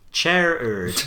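"Chair erd", first part like chair; Gerard said CHAIR-erd. Shown as a demonstration. Co-host trying out the odd pronunciation, "Chair erd" as CHAIR-erd. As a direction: say "Chair erd" ending in erd, not ard. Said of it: "It is pronounced like chair erd".

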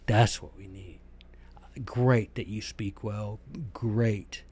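whispered, dismissive 'great'; sarcastic whisper. Real, not acted. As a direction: brush off the praise under your breath.

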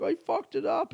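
pitched high, sulky; mock whimper. Telling housemate about the mistake, high sulky whimper. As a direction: whine it like a kid. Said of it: "And I'm like".

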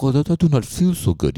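put-on high, French-accented groan of a sick old man; French grandfather impression. French grandfather at the family meal, feeling sick, French accent. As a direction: play the French grandfather, queasy at dinner. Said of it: "that's my impression of him".

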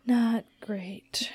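breathy unvoiced whisper of the phrase; hushed mock disapproval. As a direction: whisper it like a shared secret. Echoing in a whisper.

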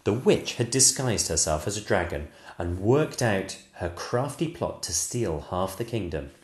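ordinary delivery, nothing marked

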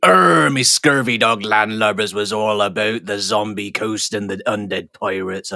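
a lightly put-on pirate voice drifting Welsh; mock pirate voice. Co-host opens the episode recap in a half-hearted pirate voice. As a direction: start the recap as a hammy pirate. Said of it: "I don't know what voice this is".

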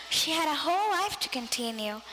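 ordinary delivery, nothing marked

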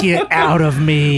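slow spooky moan, laughing through it; haunted house groaning, barely holding a laugh; ghostly house voice. Pretend. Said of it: "the ghostly voice from the house goes".

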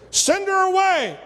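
high-pitched, mock indignant demand; indignant dismissal. Disciples urging Jesus to be rid of her, indignant dismissal.

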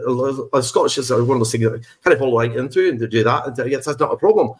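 easy, rolling Scottish lilt; Scottish accent. Shown as a demonstration. Actor showing he falls right into it, Scottish accent. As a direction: slip casually into Scottish. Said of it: "I do Scottish a little bit".

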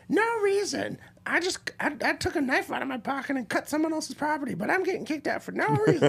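high, whiny voice mocking the woman. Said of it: "And he's like".

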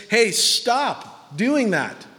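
high, drawn-out call to stop; firm exhortation. Acted. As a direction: call someone out, stretching each word. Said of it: "exhortation is".

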